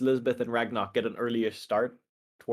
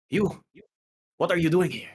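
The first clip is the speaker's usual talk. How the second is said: sharp, higher startled challenge; startled Turok; 7 semitones higher, 4 dB louder than usual. Acted. Turok confronting the intruder in the dream, startled and sharp. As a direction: snap it out like a hunter caught off guard. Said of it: "And he says".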